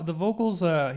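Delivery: ordinary talk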